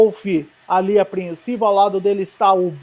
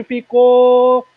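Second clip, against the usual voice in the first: excited; 3 semitones higher, 6 dB louder than usual. Real, not acted. Sector time result.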